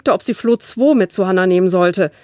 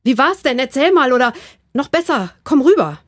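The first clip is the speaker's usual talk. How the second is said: a bit higher, curious and keen; lightly eager girl; 6 semitones higher, 3 dB louder than usual. A narrator's reading. Flo asks her friend about the holiday, lightly eager. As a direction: urge the friend to come over. Flo voiced in read story.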